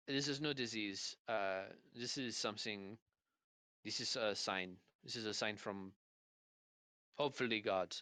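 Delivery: put-on French-accented English with searching pauses; halting, deliberate French accent; slight French accent. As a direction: pronounce solemnly in a light French accent. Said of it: "a slight French accent".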